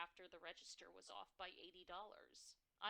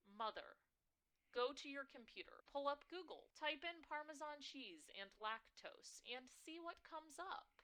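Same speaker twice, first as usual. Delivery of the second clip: impatient instructions; 8 semitones higher, 4 dB louder than usual. Acted. Daughter instructing mother impatiently.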